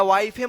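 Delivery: वाइफे with the final e sounded; wrong pronunciation. Shown as a demonstration. Teacher warning learners off a misreading, mispronounced वाइफे. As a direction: add a spoken e after f in वाइफे. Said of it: "मत पढ़िएगा".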